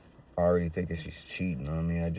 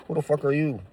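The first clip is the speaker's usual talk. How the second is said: higher, a touch louder, put-on tough challenge; mock confrontation; 10 semitones higher, 4 dB louder than usual. To a stranger in jail, higher mock-tough challenge. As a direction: challenge a stranger who claims to know you.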